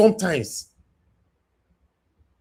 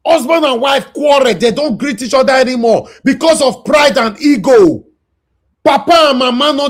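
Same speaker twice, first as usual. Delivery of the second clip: loud, raised, preaching scold; impassioned shout; 4 semitones higher, 3 dB louder than usual. Real, not acted. Host lecturing the commenters on their lack of sympathy, shouting. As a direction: drive it home, loud and heated.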